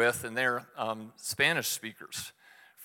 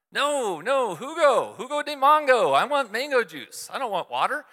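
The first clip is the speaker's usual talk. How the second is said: much higher and louder, exasperated; frustrated loud insisting; 11 semitones higher, 6 dB louder than usual. Retold or imitated. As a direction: push it louder as if volume makes it clear. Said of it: "if you say it louder".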